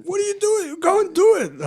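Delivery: very high mock French accent, building in volume; Nico. Guest voicing workmate pushing him to do it, French accent. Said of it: "what's the French accent?".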